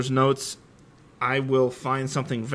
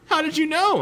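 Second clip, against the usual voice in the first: high, loud startled question; surprised; 14 semitones higher, 4 dB louder than usual. A narrator's reading. Ebony asks Voldemort how he knew, startled and surprised. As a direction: ask it in sudden surprise. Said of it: "I asked in a surprised way".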